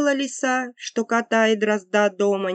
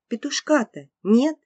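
plain reading voice

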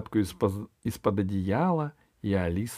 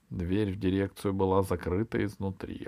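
plain reading voice

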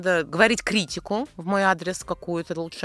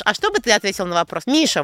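normal talking voice

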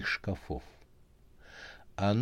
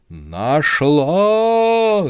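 much higher, loud triumphant cry; joyful shout; 8 semitones higher, 10 dB louder than usual. Acted. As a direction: yell it out in triumph. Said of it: "закричала гадалка".

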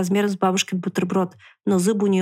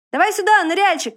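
громкий высокий оклик; позови друга издалека; окликнуть; 10 semitones higher, 4 dB louder than usual. A narrator's reading. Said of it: "окликнул его лис". (лис окликает поросёнка в воде)

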